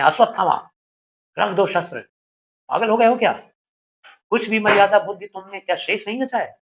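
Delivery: an elder uncle angrily scolding his reckless nephew; stern scolding. Acted. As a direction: scold him hard, like an angry elder. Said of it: "Kripacharya डांटते हैं".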